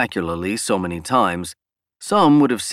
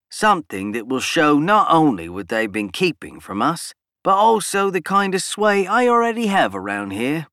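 Cole's voice; 7 semitones higher than usual. A narrator's reading. Cole's dialogue voiced by narrator.

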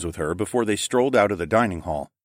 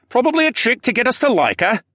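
high, louder huff of suspicion; huffy; 5 dB louder than usual. A narrator's reading. Local man tells the group Adhara is tricking them, huffy. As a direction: huff it out distrustfully. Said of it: "the man huffed".

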